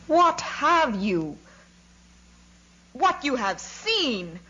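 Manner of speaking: slow, high-pitched outraged exclamation; indignant shout. A narrator's reading. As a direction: draw out each word in high-pitched indignation. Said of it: "shouted Kutuzov".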